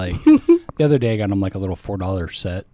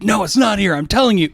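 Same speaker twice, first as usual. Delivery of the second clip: put-on high, louder stubborn child; insistent kid; 9 semitones higher, 4 dB louder than usual. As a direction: whine that the piece is missing. Kid insists piece is missing.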